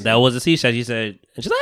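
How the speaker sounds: ordinary delivery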